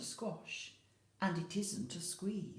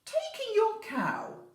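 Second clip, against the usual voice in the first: put-on wise old man; 8 dB louder than usual. Wise old man's advice.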